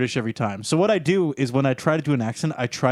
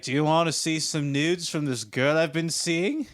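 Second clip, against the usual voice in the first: slow, labored bad Australian accent; muddled Australian accent, as if short of breath; attempted thick Australian accent; 2 semitones higher than usual. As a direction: badly imitate a drunk Australian buddy, dragging it out. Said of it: "his, like, thick accent that I'm trying to do".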